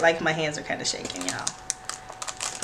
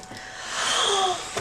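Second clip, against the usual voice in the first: delighted gasp; 13 semitones higher than usual. Real, not acted. Gasp at box contents.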